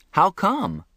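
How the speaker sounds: puzzled. A narrator's reading. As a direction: press the question again, baffled. Repeats question, higher.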